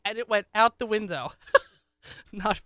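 usual speaking voice

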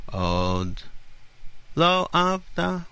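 Od lo avda, slow, stress on LO; demonstrating stress. Shown as a demonstration. Host sounding out the anthem line slowly. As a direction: linger and lift on LO in Od lo avda.

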